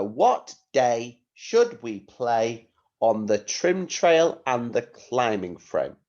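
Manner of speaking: slow, emphatic word groups; measured teacher dictation, each phrase set apart. Shown as a demonstration. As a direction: dictate it clearly for children to write. Teacher dictating sentence to pupils.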